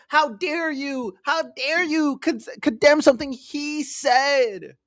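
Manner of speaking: high, whiny mocking voice; mocking whiny lefty. Mocking online leftists defending Hasan, high whiny sneer. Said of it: "going like".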